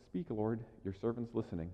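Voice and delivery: soft, hushed "Speak, Lord"; quiet prayer. Shown as a demonstration. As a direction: pray it gently, almost under the breath. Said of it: "Just go".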